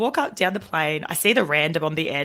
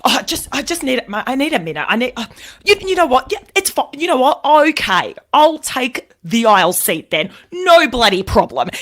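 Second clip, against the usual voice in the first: high, loud, exasperated huff that gives in; a sulky man finally caving, loudly; huffy outburst; 7 semitones higher, 6 dB louder than usual. Retold or imitated. Grumpy man caves in, huffing.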